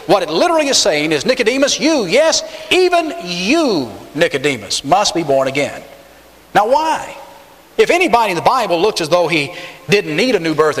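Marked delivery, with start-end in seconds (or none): 1.3-5.8 single him out, lingering on "even you"; pointed emphasis on you. Shown as a demonstration. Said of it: "you could paraphrase it like this".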